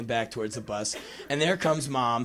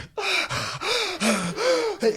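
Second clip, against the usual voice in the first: high stunned intake of breath; shocked gasp. Retold or imitated. Kid stands stunned after escape.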